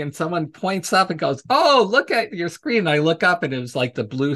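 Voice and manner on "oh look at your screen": much higher mock exclamation; imitated alarmed alert. Audience member pointing at the crashed screen, surprised. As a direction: call out a surprise to the presenter.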